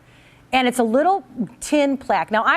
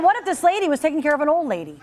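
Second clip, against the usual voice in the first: ordinary talk, nothing marked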